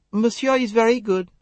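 high, light French maid voice, modest; a demure maid murmuring to monsieur. A narrator's reading. Modest French maid to the detectives. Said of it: "Louise murmured".

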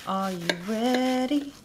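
drawn-out teasing host call; slow host catchphrase. Acted. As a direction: stretch it out like a show host. Slow showy catchphrase intro.

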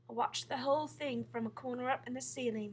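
ordinary delivery, nothing marked

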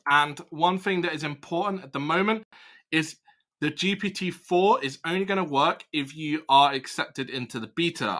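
usual speaking voice

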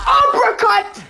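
loud high battle cry of a move; shouted attack call; shouted move name. Acted. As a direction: yell it like a fighting game attack. Calling out fighting moves.

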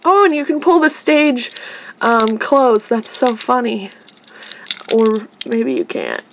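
normal talking voice

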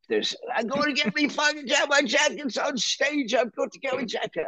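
high-pitched frantic rant, mock screaming. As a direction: imitate a rock drummer ranting at the top of his range. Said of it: "screaming and shouting".

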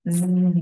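long voiced "zzz" buzz; tongue to teeth, let it vibrate. Shown as a demonstration. Teacher showing students the third ending, buzzing z. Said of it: "nó rung răng".

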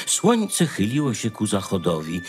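plain reading voice

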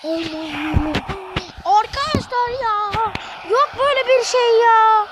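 nefesi kesilip tiz bağırış; şokla haykır; inanamayan haykırış. Real, not acted. Direkten dönen topa haykırış. (direkten dönen top sonrası izleyicilere inanamayarak haykırıyor)

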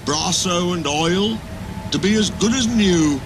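a hint of a sad old engine; a touch of sadness. Trevor telling Edward his fate, mildly sad. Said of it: "Trevor went on sadly".

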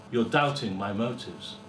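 ordinary delivery

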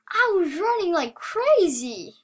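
loud, high, swooping excitement; thrilled exclamation. Real, not acted. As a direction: belt it out, giddy with relief. Thrilled after surviving.

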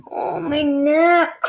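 slow, drawn-out groan of pain; pained moan. Real, not acted. Moans after painful coughing fit.

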